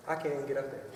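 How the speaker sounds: usual speaking voice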